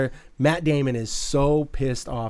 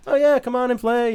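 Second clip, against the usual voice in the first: higher-pitched, easygoing welcome, imitated; happy-go-lucky invitation; 10 semitones higher, 3 dB louder than usual. Fan voices con man inviting players in, happy-go-lucky. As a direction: lightly play the carefree host. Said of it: "he's kinda like this happy-go-lucky kinda guy".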